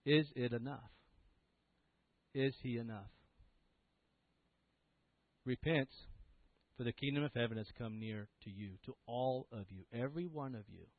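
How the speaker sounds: solemn altar call. Real, not acted. Preacher inviting the congregation to receive the gift, solemn and soft. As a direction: gently press the invitation, phrase by phrase.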